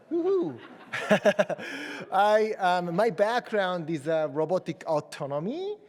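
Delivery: normal talking voice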